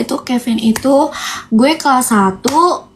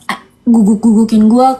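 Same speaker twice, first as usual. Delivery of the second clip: mock rude; 5 semitones lower, 4 dB louder than usual. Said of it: "kasarin gue".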